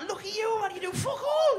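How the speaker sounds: high, loud mock outburst at his mother; hammed-up shout of scorn; mock yelling taunt. Son mocking his idle mother, hammed-up high shout.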